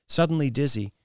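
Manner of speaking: ordinary delivery, nothing marked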